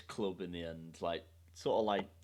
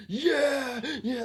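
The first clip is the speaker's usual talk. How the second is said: high excited whoop; cheering him on; 6 dB louder than usual. Retold or imitated. Mates cheering friend as he walks out, high excited whoop. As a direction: cheer your mate like he's a hero. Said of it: "cheering him on".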